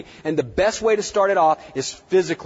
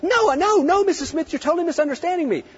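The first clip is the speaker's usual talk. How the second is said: higher, anxious pleading; match her pitch nervously; 13 semitones higher than usual. Retold or imitated. Vet answering an angry client, high and nervous. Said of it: "you're like".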